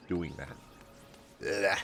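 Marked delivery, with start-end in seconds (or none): none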